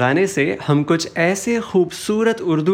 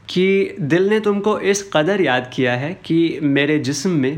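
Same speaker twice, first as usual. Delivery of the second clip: ordinary delivery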